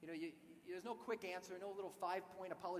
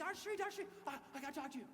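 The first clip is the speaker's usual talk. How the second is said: eager call; 8 semitones higher, faster than usual. Retold or imitated. He came running with papers.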